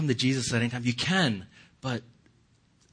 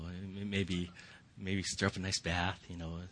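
usual speaking voice

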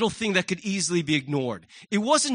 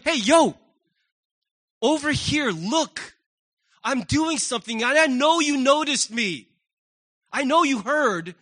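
raised, higher attention-getting call; 4 semitones higher, 4 dB louder than usual. Acted. Preacher voices God hailing a passerby, calling out. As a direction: call someone over insistently.

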